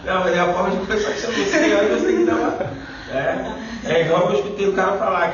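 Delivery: palavras rápidas entre risos; falando rindo. Real, not acted. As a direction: segue rindo enquanto explica. Fala ainda rindo. (falando entre risadas)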